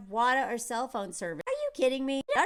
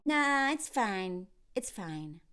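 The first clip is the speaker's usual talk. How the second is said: slow, low and mumbled; sulky pout; 4 semitones lower, 6 dB quieter, much slower than usual. Retold or imitated. Pouting boyfriend brushes off his girlfriend, slow sulky mumble. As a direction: sulk like a child pretending it's fine.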